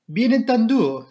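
high, loud French interjection; Poirot. A narrator's reading. Poirot agrees with the inspector, emphatic French.

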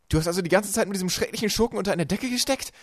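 loud, high indignant question; shouting upward. A narrator's reading. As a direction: call up the shaft, outraged. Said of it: "rief Klaus nach oben". (empört nach oben gerufen)

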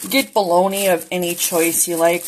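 ordinary talk

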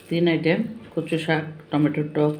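normal talking voice

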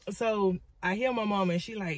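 normal talking voice

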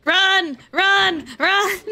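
loud, high urgent shouts of run; frantic shouting to escape; panicked yelling. Real, not acted. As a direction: yell it like you're fleeing for your life. Fleeing danger in game.

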